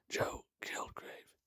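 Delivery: slow, breathless whisper of a dying man. Acted. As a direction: barely get the name out in a whisper. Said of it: "he whispered".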